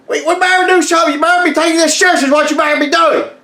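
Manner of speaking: high, fast, frantic urging; squeaky alarmed hurry; panicky. Retold or imitated. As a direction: rattle it off in a panic. Panicking about predator in woods.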